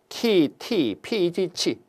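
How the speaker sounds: 키, 티, 피, 지, 치 as soft open syllables; old letter names. Shown as a demonstration. Teacher repeating the old names for the class, 키, 티, 피 softly left open. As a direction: recite 키, 티, 피 evenly, no final consonant. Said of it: "이렇게 읽었습니다".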